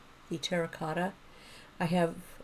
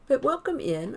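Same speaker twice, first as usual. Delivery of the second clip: excited; 7 semitones higher, 7 dB louder than usual. Real, not acted. Streamer welcoming chatter.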